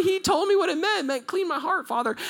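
high-pitched eager retelling; excited proclamation. Retold or imitated. Korean man explaining his prayer to the crowd, excited high voice. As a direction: gush the news in a high voice.

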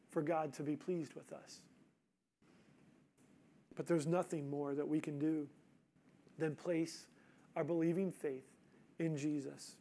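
quiet, sincere, low-key preaching; soft altar call. Acted. Soft reflection on faith alone.

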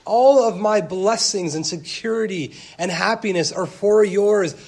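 high, loud, open-armed call to come in; voicing God, booming. Preacher booming God's welcome to the church, loud and high. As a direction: preach God's words at full force. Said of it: "God says".

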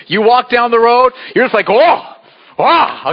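loud, high mock swagger; mock triumphant. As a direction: play the invincible warrior, big. Acting out walking invincible.

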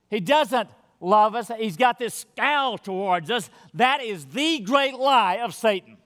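loud, rising sermon against Satan's lie; full-force preaching. Acted. Preacher warning his church about Satan's lie, loud and urgent.

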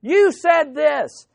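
imitating an upset visitor, high and drawn out; upset accusation. Upset churchgoer confronting pastor in his office, mock accusing. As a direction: hit each word slowly, accusingly. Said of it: "be extremely upset".

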